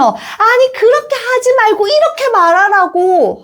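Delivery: high-pitched bossy whine; annoyed child. Retold or imitated. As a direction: imitate a kid irritated that mum got it wrong. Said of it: "못마땅해 하면서 짜증을 낸대".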